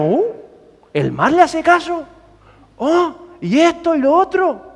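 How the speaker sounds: high, hushed gasp of wonder; high-pitched astonished questioning; mock amazement. Acting the amazed disciples.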